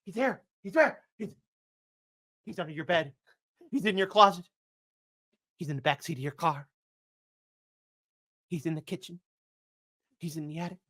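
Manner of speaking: eerie hush. Acted. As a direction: whisper it like a paranoid secret. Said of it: "will now reenact a full-on liberal panic".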